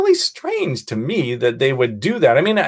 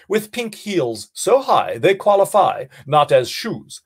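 poem recital; 3 semitones higher than usual. Shown as a demonstration. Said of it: "And here is the poem".

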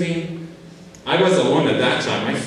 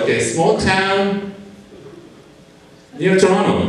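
hopeful plea; 7 semitones higher than usual. Retold or imitated. Asking the consul hopefully for a town near Toronto. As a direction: stretch it out, hoping for a yes.

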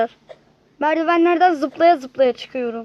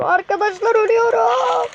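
sudden pitched-up panic; panicked cry; 8 semitones higher than usual. Real, not acted. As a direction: yelp it as you're about to die. Panicking as character dies.

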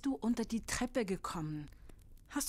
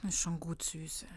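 soft, low soothing whisper; calm her gently; mother comforting her frightened child; tender whisper; 5 semitones lower, 4 dB quieter than usual. Acted. Mother comforts scared daughter.

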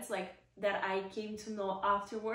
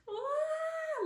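high delighted squeal; excited squeal. Retold or imitated. Squealing as she sees the little Hermes box. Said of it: "I see the box and I'm like".

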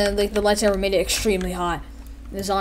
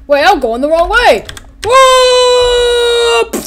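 higher, louder surprised cry; sudden alarm; 9 dB louder, much slower than usual. Retold or imitated. Toy hero noticing he's lost his way. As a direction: realize the mistake then yelp. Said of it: "He was like".

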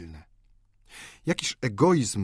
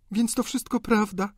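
higher-pitched, lightly voiced young sister; a hint of disbelief, pitch raised; shocked sister; 13 semitones higher than usual. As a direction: ask it in a raised feminine voice. Sister reacts to the confession.

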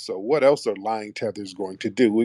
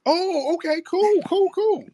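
pleased surprise. Real, not acted. Surprised to learn city.

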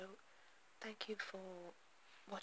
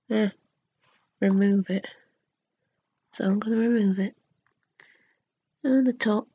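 lips framed by wet sheet; slightly stiff cheeks; wearing a sheet mask; 5 dB louder than usual. Real, not acted. Seen 2: Sheet mask covers the face throughout; mouth opening leaves the lips free.

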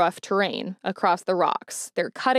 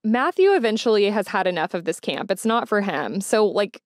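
ordinary delivery, nothing marked

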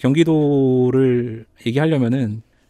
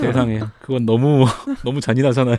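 laughing. Real, not acted. Laughing at a cheeky joke.

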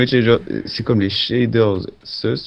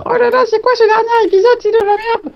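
squeaky high whiny commenter; mocking commenter voice; 5 dB louder than usual. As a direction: mimic an outraged viewer in falsetto. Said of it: "tu vas voir, ils vont dire".

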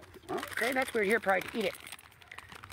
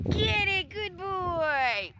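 much higher sing-song pet voice; 11 semitones higher, much slower than usual. Real, not acted. Owner coaxing a timid dog closer in high baby talk.

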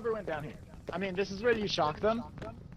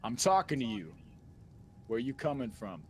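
Skyrim NPC voice; 5 semitones lower, 3 dB quieter than usual. Pretend. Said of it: "give me some Skyrim N P C".

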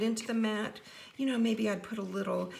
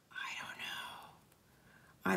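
whisper; 11 dB quieter, much slower than usual. Real, not acted. Whispered aside while crafting.

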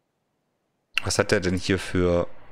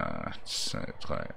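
breathy whispered count, much softer; 10 dB quieter, much slower than usual. Real, not acted. Whispering the count while stacking blocks.

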